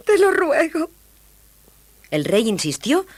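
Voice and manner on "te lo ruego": high, pleading princess. A narrator's reading. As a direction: beg sweetly in a high voice. (voz aguda de princesa suplicante)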